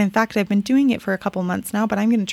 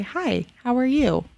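slower, unhurried hello with real attention; sincere greeting; 3 semitones higher, slower than usual. Shown as a demonstration. Mother greeting someone while looking them in the eye, slow and sincere. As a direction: take your time and mean it. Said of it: "Do you notice the difference?".

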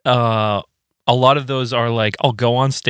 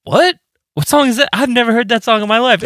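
much higher, panicked squeak; mock panic; 12 semitones higher, faster than usual. Dreamer panicking at an unknown song, high mock squeak.

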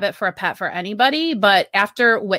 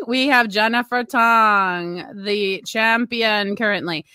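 slow, drawn-out ring announcer call; hammed-up announcer introducing the challenger; boxing announcer; slower than usual. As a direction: announce the challenger like a prize fight.

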